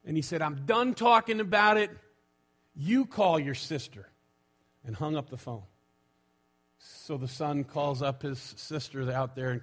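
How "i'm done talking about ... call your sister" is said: curt New York dad, higher and louder at first; acted New York exasperation; New York accent. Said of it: "And in his New York, uh, accent".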